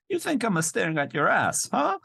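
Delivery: high, hammed-up Italian accent. Misogynist challenging her, high mock Italian accent. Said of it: "but he's like".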